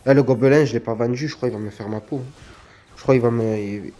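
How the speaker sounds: low, grave, mock-solemn voice. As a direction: announce your doom solemnly. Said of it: "Le ton solennel".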